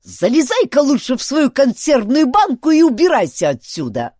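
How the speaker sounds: high, loud angry dismissal; squawking, irate chief; fierce. A narrator's reading. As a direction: order the intruder out at full voice. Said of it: "свирепо произнёс громовержец".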